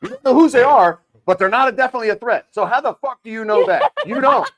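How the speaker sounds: shouted high, furious disbelief; furious rant. Real, not acted. Ranting to friends about the Pentagon's drone statement, furious shouting. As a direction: yell it right at the Pentagon's excuse.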